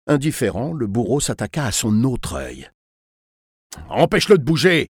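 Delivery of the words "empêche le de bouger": loud, higher, irritated order; annoyed exclamation. A narrator's reading. Torturer ordering accomplice to still the boy, irritated shout. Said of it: "s'exclama-t-il avec agacement".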